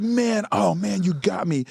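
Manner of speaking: high, amazed retelling of praise; lightly voiced delighted star; impressed. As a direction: imitate being floored by a question. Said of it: "He's like".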